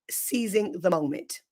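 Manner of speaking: normal talking voice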